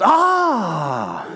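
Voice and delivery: long drawn-out "ah" of realization; acted dawning-realization "aaah"; aha moment. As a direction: let the penny drop in one stretched "ah". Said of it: "to where we have that aha moment".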